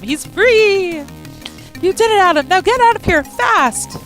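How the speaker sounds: high, loud joyful urging; excited shout. Acted. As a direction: cheer then hurry him. Delphinius cheers Adam.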